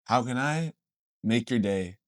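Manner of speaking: soft, deep, halting pitch; low acted salesman's hush; smooth low offer. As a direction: offer it quietly, pausing between phrases. Voicing deer making an offer.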